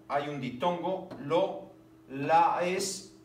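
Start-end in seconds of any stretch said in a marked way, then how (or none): 1.2-3.2 slow scansion. Shown as a demonstration. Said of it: "sin alefa".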